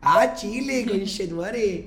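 high, loud mock Chilean accent; playful Chilean-accented exclamation; slow lightly put-on Chilean accent. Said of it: "Está hablando chileno".

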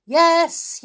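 high, loud yelled answer. A narrator's reading. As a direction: yell back from inside the tower. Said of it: "yelled a voice".

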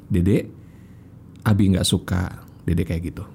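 low, calm father's reproach; scolding without anger. Shown as a demonstration. Father demo correcting Dede, calm low intonation. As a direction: tell the child off gently, voice kept low. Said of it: "Coba bisa nggak marahnya?".

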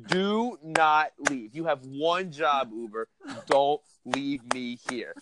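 slow, weighty do not leave; emphatic plea. Retold or imitated. Pleading with the Uber driver to stay, slow and insistent. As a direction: lean hard on each word of Do not leave. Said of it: "it would be in size Arial seventy-two font".

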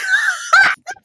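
high shrieking burst of laughter. Real, not acted. Bursts out laughing mid-sentence.

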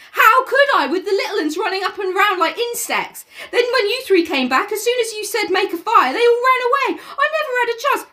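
put-on high boy complaining to Ralph; Piggy voice, protesting. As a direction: plead that it wasn't your fault. Piggy voice held.

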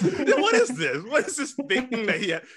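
high mock confusion.

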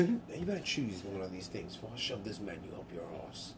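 soft low British threat; hushed tough-guy waiter; Statham waiter impression. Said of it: "my name is Jason Statham".

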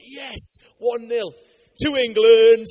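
slow, loud triumphant cheer; gloating cheer. Retold or imitated. A Brit gloating as the soldier trips over a rock, loud slow cheer. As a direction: crow over it like a football win.

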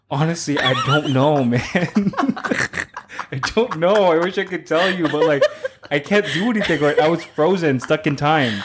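laughing. Retold or imitated. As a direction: laugh while shrugging it off. Laughing retelling frozen moment.

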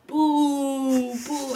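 lightly voiced mock booing; a mild imitation of booing; booing crowd. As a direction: jeer like a studio audience. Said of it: "where everyone's like".

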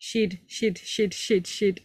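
"Shed, shed" said over and over as "shid"; "Shed, shed, shed" as a Kiwi would; New Zealand shed repeated. Shown as a demonstration. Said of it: "can you say the word shed".

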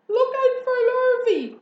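piercingly high, loud warning; calling out. A narrator's reading. Cows warning pig of hired man, hollering. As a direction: holler a warning across the yard. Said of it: "called the cows".

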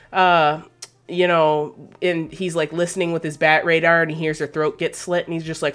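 normal talking voice

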